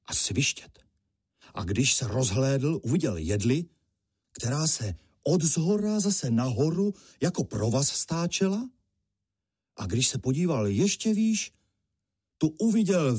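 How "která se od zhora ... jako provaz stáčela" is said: ordinary delivery, nothing marked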